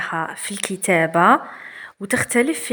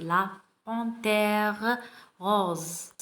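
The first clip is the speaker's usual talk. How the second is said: slow reading aloud; 4 dB quieter, much slower than usual. Shown as a demonstration. Teacher reading the text aloud to pupils, very slow. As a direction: read each word very slowly. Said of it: "الفهد الوردي".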